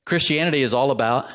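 ordinary talk

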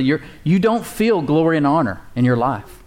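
ordinary delivery

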